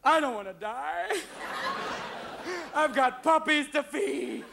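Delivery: high, slow whimpering wail; terrified dog. Acted. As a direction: plead in a drawn-out high whine. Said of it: "the other dogs who work in the bomb squad going".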